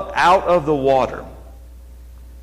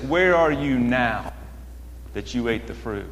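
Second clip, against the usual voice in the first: lower, softer, dropping away at the end; reworded question; 5 semitones lower, 7 dB quieter than usual. Acted. Preacher rephrases the Father's question after the fall, low and quiet. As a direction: let the question sink down quietly. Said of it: "You might say it like this".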